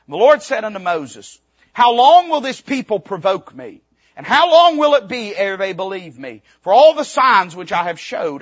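solemn, loud reading of the Lord's words; scripture reading. Acted. Preacher reading God's words to Moses, solemn and loud. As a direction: proclaim the verses to the church.

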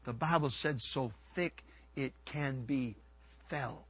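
soft, low preaching, almost murmured; hushed sermon storytelling. Acted. Preacher softly retelling the darkness over Egypt, hushed. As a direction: draw the congregation in quietly.

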